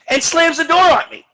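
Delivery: ordinary delivery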